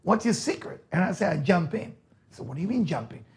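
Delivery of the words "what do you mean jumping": normal talking voice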